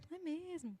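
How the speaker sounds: hushed, overly gentle imitation of agreeing; meek soft-spoken agreement. Preacher mimics someone who accepts everything, meek and soft. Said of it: "fala baixinho".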